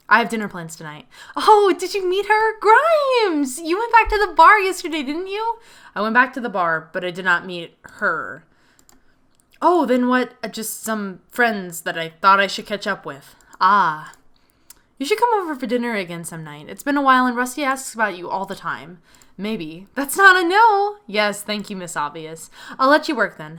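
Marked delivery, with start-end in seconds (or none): 1.4-5.6 high, bright-eyed teasing friend voice; excited friend. Acted. Friend voice catching Grimes out about the bar, high and teasing. Voicing excited female game character. 19.9-21.0 teasing friend. Acted. Same friend character teasing.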